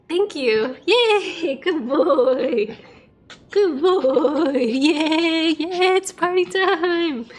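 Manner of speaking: high gleeful praise with laughter; excited dog praise. Real, not acted. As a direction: cheer for the dog like it did a trick.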